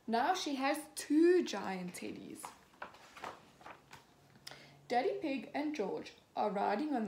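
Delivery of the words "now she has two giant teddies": usual speaking voice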